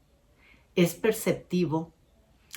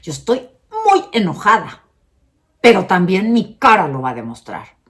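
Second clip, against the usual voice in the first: much louder angry outburst as a demo; teacher acting out anger, much louder; 3 semitones higher, 12 dB louder than usual. Shown as a demonstration. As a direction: show anger plainly while teaching. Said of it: "acentúa o refuerza".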